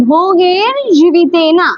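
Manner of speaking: ভোগের জীবিতেনা chanted slowly, syllable by syllable; চেনা সংস্কৃত আবৃত্তির ঢঙে ধীরে ভোগের জীবিতেনা; slow Sanskrit recitation. Shown as a demonstration. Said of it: "উচ্চারণটা ভালো লাগবে শুনতে".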